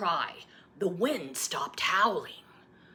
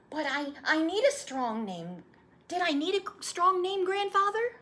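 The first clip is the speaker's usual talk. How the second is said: put-on high, eager child voice; a little boy pleading for the story; young boy; 14 semitones higher, 4 dB louder than usual. Boy asking his grandfather to retell his birth, eager child voice.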